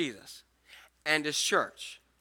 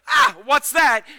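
higher, louder alarmed cry; mock fright; 8 semitones higher, 9 dB louder, slower than usual. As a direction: play the scared disciple, loud and jumpy. Disciples' fear acted out.

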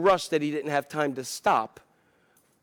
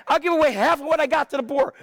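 rushes the pledge out in one breath, higher; blurted out fast; 9 semitones higher, faster than usual. Acted. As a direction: blurt it all out at once, eager. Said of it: "he just blurts out".

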